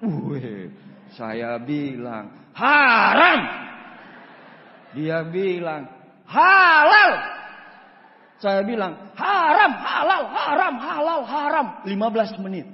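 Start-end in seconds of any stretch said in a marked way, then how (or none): 2.5-3.4 loud stubborn retort; shouted retort. Retold or imitated. Shouting debate. 6.3-7.1 loud, high shout of halal; loud stubborn counter; shouted retort. Retold or imitated. Shouting debate. 9.2-11.7 loud, high back-and-forth yelling; loud laughing squabble; rapid shouting match. Retold or imitated.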